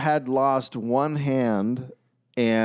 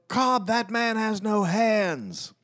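high, drawn-out mock call from the stands; held-back pretend shout, high and slow; imitated yell; 11 semitones higher, slower than usual. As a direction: call out like an appalled spectator, without real volume. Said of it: "Someone yelled".

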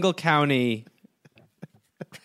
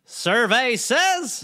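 game show host; 9 semitones higher, much slower than usual. Pretend.

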